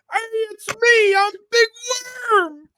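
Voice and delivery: very high, loud shouted greeting; mock shout. Imitating Big Worm walking around, loud high shouted greeting. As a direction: shout it to everyone you pass. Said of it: "you just walk around going".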